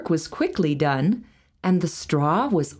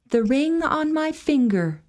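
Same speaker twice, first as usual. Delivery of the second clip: put-on much higher young girl's voice; high maiden voice; 9 semitones higher than usual. A narrator's reading. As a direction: answer as the timid maiden. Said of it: "said she".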